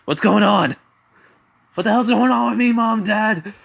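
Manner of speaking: high, loud, bewildered outburst; panicked shouting. Acted. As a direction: cry out to your parents in alarm. Izuku panics over changed personality.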